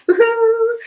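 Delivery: high, loud woohoo; cheering. Real, not acted. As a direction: cheer with a laugh. Celebrating the finished piece.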